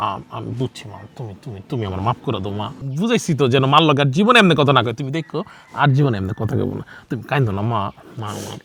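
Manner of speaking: soft, low, gentle coaxing; tender comforting. Acted. Son gently comforting his crying mother, soft and low. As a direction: hush her tears tenderly.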